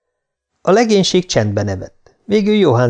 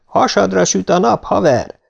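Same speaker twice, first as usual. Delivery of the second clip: higher-pitched wake-up call; 8 semitones higher than usual. A narrator's reading. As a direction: rouse a sleeping buddy. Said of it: "kiáltott Martinez". (ébresztően odakiáltva)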